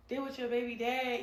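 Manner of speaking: normal talking voice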